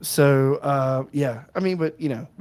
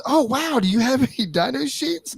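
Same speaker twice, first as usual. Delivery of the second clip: much higher, faux-impressed innocent question; passive-aggressive impression; 9 semitones higher than usual. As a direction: imitate a friend feigning wide-eyed interest. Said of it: "the most passive aggressive motherfucker".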